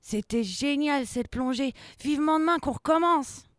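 high, gleeful voice; delighted child. Acted. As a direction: rave about the adventure. Child delighted after the game. (voix aiguë d'enfant ravi)

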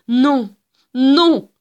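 non hurlé, aigu et fort; hurlement. Acted. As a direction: hurler de désespoir. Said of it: "hurlait-elle". (Gilda hurle aux agents, cri aigu)

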